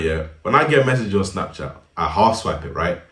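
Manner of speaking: ordinary talk, nothing marked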